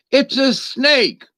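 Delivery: high, slow insistence: it's a snake; drawn-out exasperated reply, pitched high; deadpan retort. Acted. Flat retort to naive optimist.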